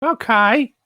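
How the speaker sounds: high rising put-on Australian okay; a bit Muppet-like Aussie okay; lightly put-on Australian accent. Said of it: "You sound like a Muppet".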